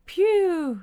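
high, loud falling "phew"; relieved phew. A narrator's reading. Bob after the bees have left, relieved. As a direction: let out a big sigh of relief.

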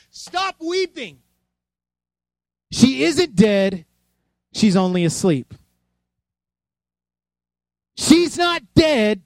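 slow, emphatic command over a weeping crowd; Jesus calling out. Retold or imitated. Jesus to mourners at the funeral, calling out. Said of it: "and Jesus says this".

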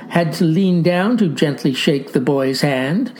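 ordinary talk, nothing marked

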